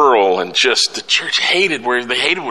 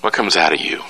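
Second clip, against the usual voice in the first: usual speaking voice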